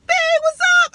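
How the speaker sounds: teasing high-pitched hello; playful selfie greeting. Retold or imitated. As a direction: pop up high and cheerful for the camera. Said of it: "I wanted a selfie like".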